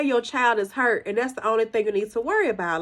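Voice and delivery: normal talking voice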